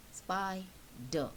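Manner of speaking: "Spider" in two separate beats, SPI, pause, DER; split into syllables. Shown as a demonstration. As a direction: break "Spider" between its syllables. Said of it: "cut up into two syllables".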